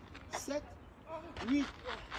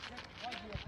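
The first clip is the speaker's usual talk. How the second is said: counting workout reps; 4 semitones higher, 7 dB quieter, slower than usual. Real, not acted.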